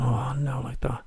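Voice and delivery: hushed, whispered cringe; retold whispered dismay. Storyteller recalls his embarrassment at the door, whispered dismay. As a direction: whisper it to yourself, mock mortified.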